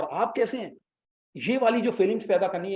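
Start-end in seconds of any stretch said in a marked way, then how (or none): 0.0-0.8 कैसे lifted higher, a warm eager greeting; warmly emphatic, pitch raised on कैसे; with warmth. Shown as a demonstration. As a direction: ask "आप कैसे हैं" like you really mean it. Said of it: "थोड़ा सा गर्म जोशी से बोल रहे हैं".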